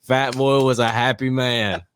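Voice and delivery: usual speaking voice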